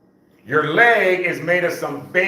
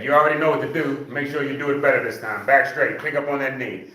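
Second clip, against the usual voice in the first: ordinary delivery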